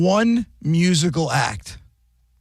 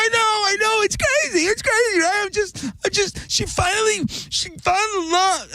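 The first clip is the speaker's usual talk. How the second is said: much higher, weepy happy gush; hammed-up crying for a celebrity couple; mock tearful gushing. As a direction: imitate a friend sobbing with joy. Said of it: "and she's crying".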